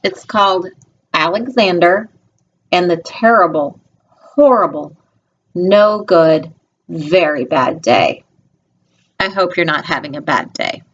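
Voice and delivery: usual speaking voice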